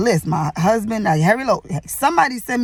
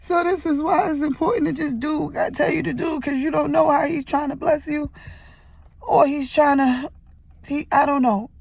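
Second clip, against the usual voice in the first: choked up, voice pushed much higher; crying through the words of a testimony; tearful; 7 semitones higher than usual. Real, not acted. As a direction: overcome with tears, breaking high. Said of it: "I lost it again. I said, I couldn't talk".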